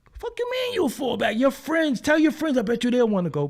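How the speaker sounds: high, loud disbelieving outburst; incredulous yell. Retold or imitated. Uncle yelling at Little Buddy for falling back, loud incredulous rant. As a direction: shout it like you can't believe him.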